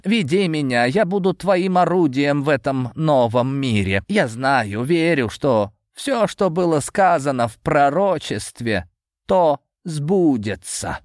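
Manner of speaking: a zealot pledging himself; fervent old servant. A narrator's reading.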